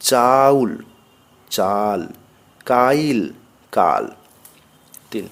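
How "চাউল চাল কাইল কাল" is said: long drawn আ in চাউল, চাল, কাইল, কাল; long vowel. Shown as a demonstration. Teacher showing long আকার, stretched in চাউল, চাল, কাইল, কাল. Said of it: "আকার দীর্ঘ".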